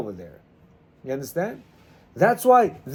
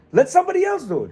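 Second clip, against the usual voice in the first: whiny plea; 10 semitones higher, faster than usual. Retold or imitated. Imitated husband pleading to Hashem.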